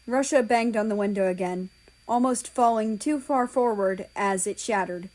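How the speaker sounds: plain reading voice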